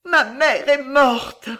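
slow, high, loud wail; horrified cry. A narrator's reading. Child realising her mother is dead, high anguished cry. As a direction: wail it out in shock. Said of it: "râla-t-elle".

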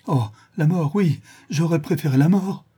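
high-pitched lament; anguished cry. Acted. Blinded schoolmaster preferring death, anguished. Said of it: "s'écria le maître d'école".

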